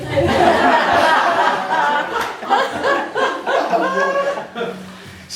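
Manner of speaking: loud high laughter; big laugh. Real, not acted. Guest recalling Mary's Tennessee cheer, laughing loudly. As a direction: let the laugh ring out.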